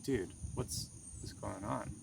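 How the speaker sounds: soft, hushed worried question; asking the crying friend softly what is wrong, hushed and concerned; gentle concern. Retold or imitated. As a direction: quietly check on a crying friend.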